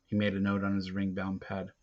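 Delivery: usual speaking voice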